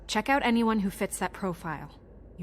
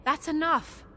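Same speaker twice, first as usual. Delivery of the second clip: sharp high command; snapping; 8 semitones higher than usual. Acted. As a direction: snap it to stop him. Deputy stops the argument.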